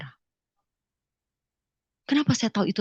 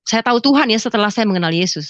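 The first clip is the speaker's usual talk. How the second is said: ordinary delivery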